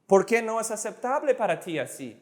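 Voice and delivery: fast demonstration. Shown as a demonstration. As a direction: rattle it off in one go. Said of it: "fast, slow again".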